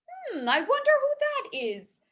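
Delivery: high curious voice; old woman wondering. Acted. Baba Brina hears a knock.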